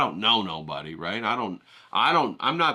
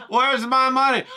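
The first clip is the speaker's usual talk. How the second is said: mock outrage; 13 semitones higher, 7 dB louder than usual. Demanding payment laughing.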